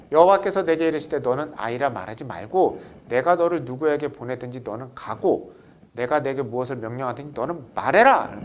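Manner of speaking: loud, measured scripture recitation; scripture reading. Acted. Teacher leading the class in a scripture reading, loud and measured. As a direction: read it out like the pulpit Bible.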